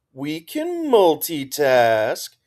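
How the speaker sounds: slow sing-song crowing; drawn-out, teasing sing-song. A narrator's reading. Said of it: "Trix's sing-song voice crowed".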